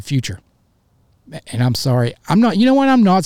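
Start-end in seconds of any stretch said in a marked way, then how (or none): 2.3-3.2 sudden high, loud outburst; real flare of heated excitement; fired up. Real, not acted. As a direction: burst out, way up in pitch. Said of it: "I was fired up today".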